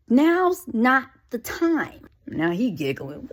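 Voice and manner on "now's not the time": slow, higher, word-by-word refusal; fed-up and drawn out; exasperated. Acted. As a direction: shut him down with slow, heavy emphasis. Exasperated reply to riddle question.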